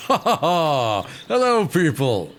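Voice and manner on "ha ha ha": slow theatrical ha ha ha; hammed-up bard laugh. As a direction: laugh grandly at your own joke. Bard's put-on laugh.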